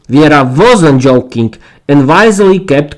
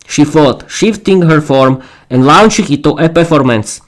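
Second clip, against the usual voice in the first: usual speaking voice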